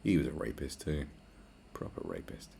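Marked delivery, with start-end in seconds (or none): 1.7-2.5 unvoiced low whisper; hushed whispered remark; whispered aside. Aside muttered.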